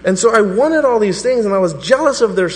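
loud, high preaching confession; fervent sermon confession; preaching at full force. Acted. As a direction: pour out the confession to the congregation.

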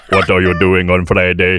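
stiff robotic cyborg voice. Retold or imitated. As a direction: deliver it like a cyborg. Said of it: "in a cyborg autonomous voice".

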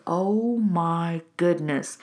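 slow, drawn-out astonishment; jaw-dropped shock. Retold or imitated. Life coach recalling her screen time audit, jaw-dropped. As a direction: stretch it out in retold disbelief. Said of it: "my jaw dropped when I saw".

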